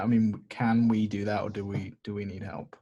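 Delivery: usual speaking voice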